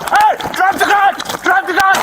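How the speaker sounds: sharp commanding shout at a gunman. Real, not acted. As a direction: yell the order, full force. Officer confronts armed man.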